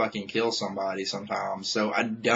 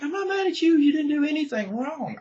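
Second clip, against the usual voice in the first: much higher, soothing quoted reply; reassuring her. Retold or imitated. Telling his friend he isn't mad at her, high gentle voice. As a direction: play it sweet and high to calm her down. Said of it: "I'm like".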